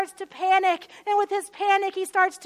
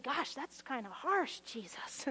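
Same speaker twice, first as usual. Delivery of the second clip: muttered aside; 5 semitones lower, 13 dB quieter than usual. Retold or imitated. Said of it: "We hear that and we think".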